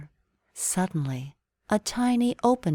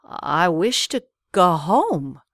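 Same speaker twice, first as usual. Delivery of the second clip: stammering; 5 dB louder, slower than usual. A narrator's reading. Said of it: "Aladdin stammered in utter amazement".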